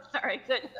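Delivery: talking through laughter; giggling apology; laughing. Real, not acted. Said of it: "I'm trying not to laugh".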